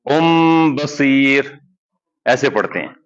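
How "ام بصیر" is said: ام بصیر with the lips closed on a clear M, slow; clear meem. Shown as a demonstration. Teacher demonstrating a clear M, slow recitation. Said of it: "میم clear پڑھ لیا".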